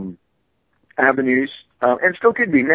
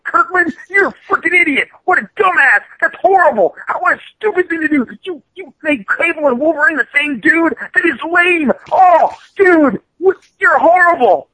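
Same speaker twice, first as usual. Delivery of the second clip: mock rant; 9 semitones higher than usual. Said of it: "and I said".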